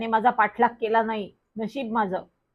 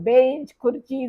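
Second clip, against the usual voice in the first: usual speaking voice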